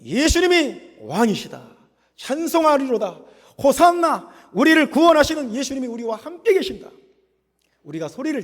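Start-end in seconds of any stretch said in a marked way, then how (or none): 0.0-6.9 building, high-pitched cry of praise; shouted praise. Shown as a demonstration. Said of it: "그냥 계속 소리 질러야 돼요". (소리 높여 외치는 찬양)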